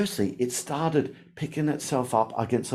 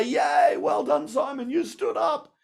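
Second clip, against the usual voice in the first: high mock cheering shout; 14 semitones higher, slower than usual. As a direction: cheer sarcastically for a fallen adult. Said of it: "you wouldn't say".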